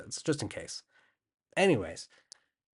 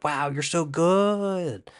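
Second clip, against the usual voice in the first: high, drawn-out admiration; slow, hammed-up cooing compliment; gushing mock praise; 5 semitones higher, much slower than usual. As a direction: stretch it out in exaggerated awe. Voices spirits praising Yoda.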